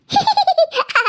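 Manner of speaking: a high, gleeful giggle; cheeky laugh. Acted. A daughter laughs at her sister's trick, gleeful.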